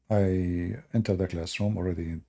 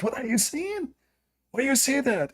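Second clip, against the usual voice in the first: high-pitched indignant questioning; angry woman; 13 semitones higher than usual. Retold or imitated. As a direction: imitate an outraged woman, pitched way up. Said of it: "like, so angry".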